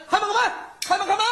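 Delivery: banging on the gate. Acted. Guards pound on gate.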